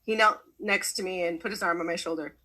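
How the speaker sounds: ordinary delivery, nothing marked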